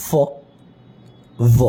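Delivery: ফ as a hissed f, ভ as a buzzed v; teeth-on-lip F and V. Shown as a demonstration. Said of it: "upper teeth and lower lip থেকে". (ফ, ভ উপরের দাঁত আর নিচের ঠোঁট দিয়ে)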